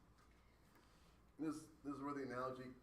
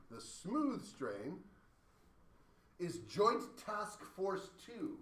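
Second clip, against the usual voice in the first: slow dramatic reveal; 4 semitones higher, 3 dB louder, much slower than usual. Acted.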